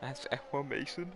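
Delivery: yawning mid-sentence. Real, not acted. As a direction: say it through a yawn. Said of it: "I'm yawning".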